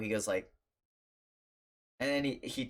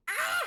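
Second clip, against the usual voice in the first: gasp, then high shriek; mock shriek of horror after a gasp; horrified scream; 4 dB louder than usual. Parents seeing the stuck thumb, horrified screaming.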